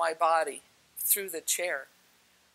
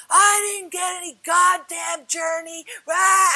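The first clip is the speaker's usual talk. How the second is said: high, loud mock tantrum; pissed-off fit; 11 semitones higher, 8 dB louder than usual. Imitating the man in the corner having a fit, angry shout. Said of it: "he's pissed off".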